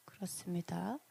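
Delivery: breathy whisper to himself; 혼잣말 속삭임; whispered aside. Real, not acted. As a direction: drop to a whisper, off-mic. Aside while fixing slides.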